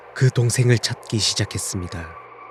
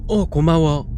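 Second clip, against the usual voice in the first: higher, lightly acted offhand thanks; distracted thanks; 5 semitones higher, slower than usual. A narrator's reading. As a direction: thank them without really looking up. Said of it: "라고 말한 뒤 다시 게임에 집중했고".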